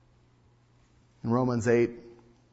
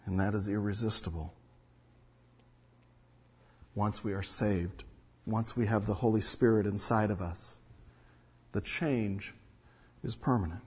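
hushed emphasis; 4 semitones lower, 7 dB quieter than usual. Acted.

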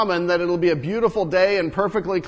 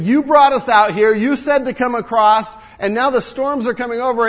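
higher, louder, blaming in panic; panicked accusation; 7 semitones higher, 7 dB louder than usual. Acted. Panicking fishermen blame Jesus for the storm, loud and frantic. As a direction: shout over the storm at him. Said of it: "and they're panicking".

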